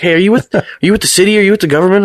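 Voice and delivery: high, pushy imitation of a wary local; mock suspicious neighbor. People asking if he's government.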